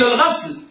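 "الغسل" with a after the ghayn: ghasl; فتح الغين. Shown as a demonstration. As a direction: say "الغسل" as al-ghasl, open a vowel. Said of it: "الغسل بالفتح هكذا". (نطق "الغسل" بفتح الغين)

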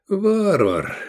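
high, falling exasperated exclamation; sighing. A narrator's reading. Teacher gives up on his barbarian pupil, sighing. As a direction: let it fall with a touch of a sigh. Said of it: "вздохнул Искандер".

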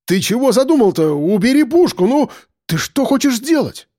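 high-pitched alarmed protest; panicked pleading. A narrator's reading. Frightened man facing a pistol.

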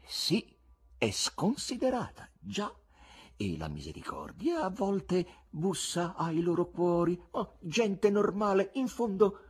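high, lingering thoughtful voice; a hint of a master pondering aloud; musing master. A narrator's reading. Master magician weighing up the crowd, lightly voiced musing. Voland muses on human nature.

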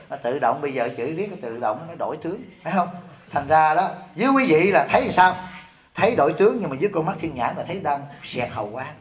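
normal talking voice

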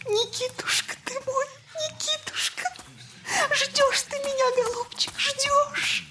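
high, sobbing, tender cry; reaching out to him through tears; crying longing. Acted. Mother calling her absent husband Nikitushka, crying.

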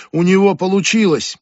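high, loud panicky cry, stress on него; shrill edge of panic, voice shooting up; near panic. Acted. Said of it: "В голосе Ребекки едва не паника".

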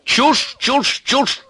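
very high, irritable exclamations; brisk old sage. A narrator's reading. Sage rushing through reception, irritable. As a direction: toss out curt orders in a high voice. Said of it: "сказал он на ходу".

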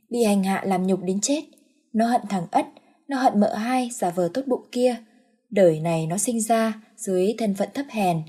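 usual speaking voice

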